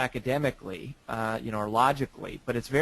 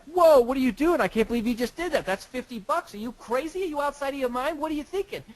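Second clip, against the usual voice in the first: shocked disbelief; 12 semitones higher than usual. Retold or imitated. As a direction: play the stunned friend who can't believe it. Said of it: "Dave is totally shocked, he's like".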